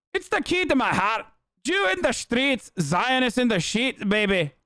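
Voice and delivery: high mock Scottish voice; Eve Fartlow impression, Scottish accent. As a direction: read it as her in a high, put-on Scottish accent. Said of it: "my regular Eve Fartlow impression here".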